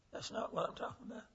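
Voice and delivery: fast whispered correction; hushed denial. Retold or imitated. Preacher quickly whispering a denial to the inmate. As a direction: brush it off under your breath, quickly.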